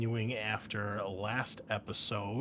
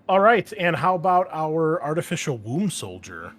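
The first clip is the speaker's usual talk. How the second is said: much louder, higher, rallying the table; loud host call; 6 semitones higher, 11 dB louder than usual. Real, not acted. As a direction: announce the next player. Host calling next player.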